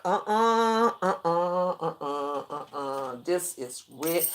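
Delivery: uh-uh fading from soft to very soft, ending in a hush; a refusal that dies away to nothing. Retold or imitated. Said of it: "but I was like".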